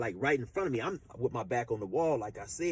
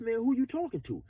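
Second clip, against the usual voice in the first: higher, challenging pushback; retold defiant comeback; 10 semitones higher than usual. Young inmate answering the gangster, a hint of defiance. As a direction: throw it back at him, offended. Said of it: "Chill be doing the voices".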